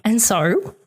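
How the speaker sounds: ordinary delivery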